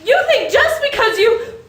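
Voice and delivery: shocked disbelief. Acted. Violet shocked by George's wild plan.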